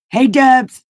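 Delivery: high, loud call across the room. Real, not acted. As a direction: sing out a greeting to someone off-mic. Calling out to someone nearby.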